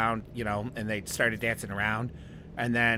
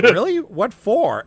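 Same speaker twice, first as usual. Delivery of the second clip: much higher and louder, incredulous; amazed disbelief; 10 semitones higher, 10 dB louder, slower than usual. Retold or imitated. Retelling his reply to the pit Nazi confession, incredulous and high. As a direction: shoot up in disbelief.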